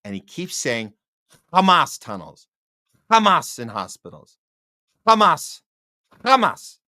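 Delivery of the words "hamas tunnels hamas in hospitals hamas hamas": "Hamas" barked out loud with a hard throaty first sound; mocking mispronounced Hamas. Host mocking how Bernie keeps saying it, mocking loud throaty "Hamas". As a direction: hit "Hamas" loudly each time, imitating his throaty K-like start. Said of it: "saying it with that accent".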